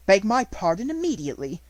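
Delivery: loud, high, commanding; stern demand. A narrator's reading. Headmistress demanding an apology, loud and stern. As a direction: order an apology at once. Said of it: "said Miss Minchin".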